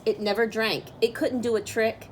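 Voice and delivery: usual speaking voice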